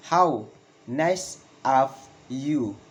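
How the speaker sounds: slow, word by word, "you" falling; slow falling exclamation. Shown as a demonstration. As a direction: pause after each word for learners. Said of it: "Valid intonation in exclamation".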